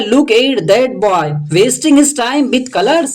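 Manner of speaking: very slow, word by word English read. Shown as a demonstration. Teacher reading the English sentence slowly to students. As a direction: lay each word out slowly. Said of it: "teacher reads English line".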